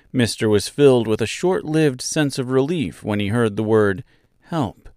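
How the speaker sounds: usual speaking voice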